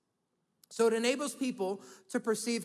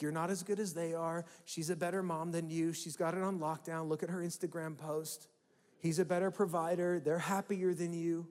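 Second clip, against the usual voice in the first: comparing inner voice; 5 semitones lower, 7 dB quieter than usual. Retold or imitated. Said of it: "a comparing voice".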